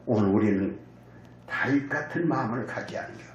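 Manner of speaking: usual speaking voice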